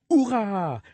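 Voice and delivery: high mock cheer; playful cheer. Parent cheering in the song for a child, playful high cheer. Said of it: "crie hurrah".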